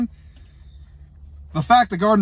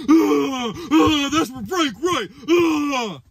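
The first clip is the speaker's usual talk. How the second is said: high, mocking whiny "ah" sounds; hammed-up sneering coach voice; mock coach whine; 6 semitones higher, much slower than usual. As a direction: imitate a smug coach crowing. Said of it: "you're sitting there like".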